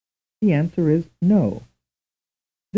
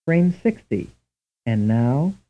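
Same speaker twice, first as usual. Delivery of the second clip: usual speaking voice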